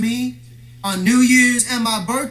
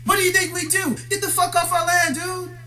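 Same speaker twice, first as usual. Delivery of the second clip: pitched up, fired-up tirade at his enemies; heated high rant; 7 semitones higher than usual. Real, not acted. Ranting at the stalkers to leave him alone, pitched high and heated. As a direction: hammer each this like an accusation.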